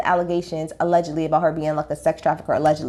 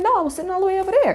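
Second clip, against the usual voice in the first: much higher, rapid put-on voice; mock castmate; 9 semitones higher, much faster than usual. Retelling the plane seat spat, high quick mock voice. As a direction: rattle it off in a high squeaky impression.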